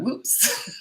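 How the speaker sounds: high squeaky oops; a kid caught out, high-pitched; mock kid. Kids realizing they're caught, high mock oops. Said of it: "they would've gone".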